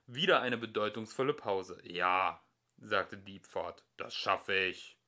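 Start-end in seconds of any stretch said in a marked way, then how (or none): 1.8-5.0 lower, slow, measured machine voice; lower, slow computer; deliberate computer voice. A narrator's reading. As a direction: speak like a great ponderous computer. Diepfort the computer speaks.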